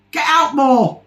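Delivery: loud, high dismissive shout; exasperated yell. Real, not acted. Telling off fans of an Irish accent, exasperated shout. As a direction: shout it at them in scorn. Scolds the admirers, calling out.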